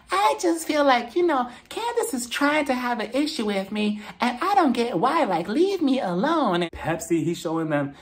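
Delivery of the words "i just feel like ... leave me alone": high-pitched whiny imitation; put-on higher voice, drawing out the plea; Portia. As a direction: mimic a friend complaining in a high voice. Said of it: "Portia was telling Giselle".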